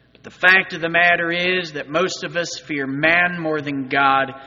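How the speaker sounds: ordinary talk, nothing marked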